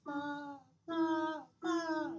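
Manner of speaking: falsetto. Shown as a demonstration. Vocal coach showing falsetto on la syllables. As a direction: demonstrate falsetto on la. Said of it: "when I think about falsetto".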